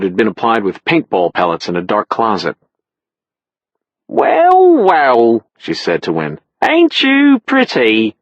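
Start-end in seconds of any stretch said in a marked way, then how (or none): 4.1-5.5 flirty barmaid. Acted. 6.6-8.2 flirty barmaid. Acted.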